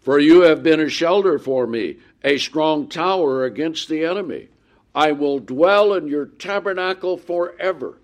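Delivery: bright triumphant pulpit reading; preaching scripture. Acted. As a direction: ring it out over the congregation. Reads psalm aloud from pulpit.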